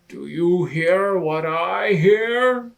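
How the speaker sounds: loud wondering question; amazed Lion. Acted. Lion asks Rabbit if he hears the rock, amazed. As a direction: ask in disbelief.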